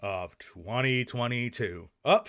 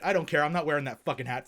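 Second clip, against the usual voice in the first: ordinary talk, nothing marked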